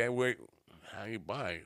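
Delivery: ordinary delivery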